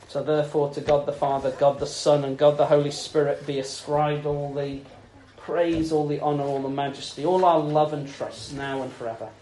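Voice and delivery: low, soft solemn benediction; solemn doxology. Acted. Preacher closing the service, low solemn blessing. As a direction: close the sermon in reverent praise.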